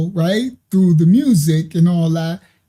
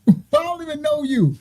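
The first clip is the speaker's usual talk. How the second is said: high mock reply; 7 semitones higher than usual. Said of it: "He like".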